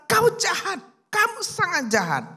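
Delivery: high, loud furious accusation; angry outburst. A narrator's reading. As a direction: hurl the accusation at him. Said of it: "pastornya marah sekali".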